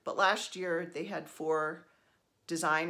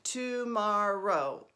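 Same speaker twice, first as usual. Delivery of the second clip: ordinary delivery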